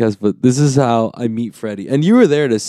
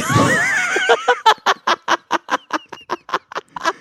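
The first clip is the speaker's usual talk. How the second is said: shrieking laugh. Real, not acted. Explosive laugh at the joke.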